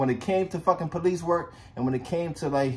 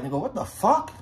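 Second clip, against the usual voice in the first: higher, disbelieving reaction; retold shock; 4 semitones higher than usual. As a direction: replay a stunned reaction to bad news. Shocked reply to death news.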